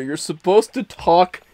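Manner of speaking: usual speaking voice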